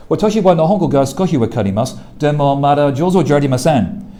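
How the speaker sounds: quick Japanese phrase as a demo. Shown as a demonstration. Teacher demonstrating Japanese vocal placement, fast. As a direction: rattle off a Japanese sentence, fast. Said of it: "if you're Japanese".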